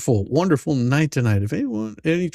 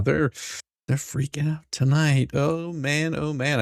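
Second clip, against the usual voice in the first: ordinary delivery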